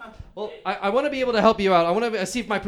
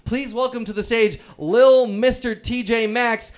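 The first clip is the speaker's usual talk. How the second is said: announcer building up to a big entrance; scripted stage introduction; 2 semitones higher, 3 dB louder, slower than usual. Acted. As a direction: stretch out the name like a ring announcer. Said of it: "it looks like I have to say".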